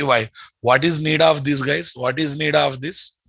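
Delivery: normal talking voice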